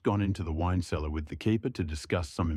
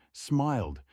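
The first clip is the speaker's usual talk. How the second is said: ordinary delivery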